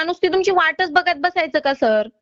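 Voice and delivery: normal talking voice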